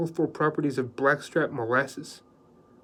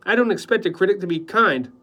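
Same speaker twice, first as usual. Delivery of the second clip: indignant; 6 semitones higher, 7 dB louder than usual. Acted. Husband bristles at critic remark.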